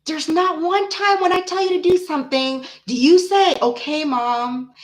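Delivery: retold high-pitched telling-off of a son; scolding mom. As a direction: imitate yourself lecturing your child, exasperated. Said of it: "I always say to him".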